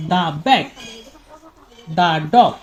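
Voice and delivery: "the bag, the dog" with "the" said as "দা"; the with da sound. Shown as a demonstration. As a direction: say "the" like "দা" before a consonant. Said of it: "আমরা বলবো". ("the" কে "দা" উচ্চারণ করে বলা)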